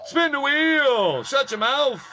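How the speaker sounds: loud, high, slow announcer call; announcer shout. Acted. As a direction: boom it out like a ring announcer. Announcer calling out to chat.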